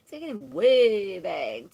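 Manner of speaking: loud, high burst on "way back"; amused, exaggerated call; playful emphatic shout. Real, not acted. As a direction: belt it out after a laugh, stretching "way". Repeats heard phrase, laughing, exaggerating.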